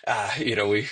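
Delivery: ordinary delivery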